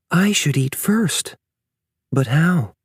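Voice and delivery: high, slow, puzzled inner voice; mother bear wondering how to feed the pup. A narrator's reading. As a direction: think it through aloud, unsure. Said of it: "she wondered".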